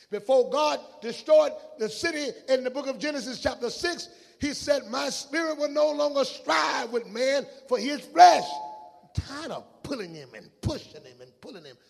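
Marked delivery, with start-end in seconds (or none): 0.1-8.5 high, loud, urgent sermon delivery; fervent preaching with pleading outbursts; full-force preaching. Real, not acted. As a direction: build to a shouted pulpit cry. Preacher declaims scripture at full force.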